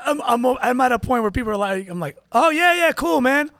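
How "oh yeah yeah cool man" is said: fake cheerful small talk. Faking small talk with a stranger.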